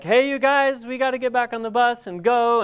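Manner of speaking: high-pitched holler to the group; calling out. Retold or imitated. People calling friends back to the bus, high call.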